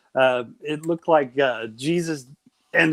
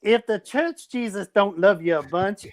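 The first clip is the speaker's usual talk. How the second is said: usual speaking voice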